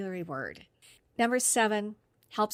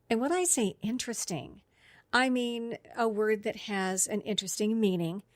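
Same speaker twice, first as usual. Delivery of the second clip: ordinary delivery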